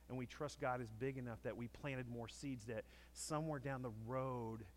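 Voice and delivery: ordinary talk, nothing marked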